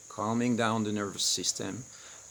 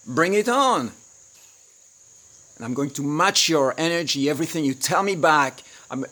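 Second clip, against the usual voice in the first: much louder and higher, hammed-up fight challenge; matching her anger; 4 semitones higher, 8 dB louder than usual. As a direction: throw her rage right back, loud and high. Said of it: "and you go like".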